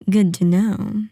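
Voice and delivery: ordinary delivery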